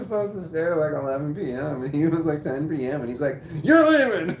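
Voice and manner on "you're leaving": very high, loud mock order; retold yell. Angry manager sending employee home early, mock yelling. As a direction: imitate a furious boss yelling, amused. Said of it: "he started yelling at me".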